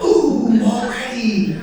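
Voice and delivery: dismayed. Acted. Driver hearing of a problem from the officer, dismayed. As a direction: act out a pained "ooh".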